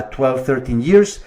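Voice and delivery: ordinary talk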